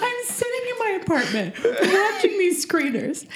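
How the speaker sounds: high-pitched, helpless tearful laughter; laughing and crying. Real, not acted. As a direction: protest through laughter that sounds like sobbing. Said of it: "she's laughing and crying at the same time".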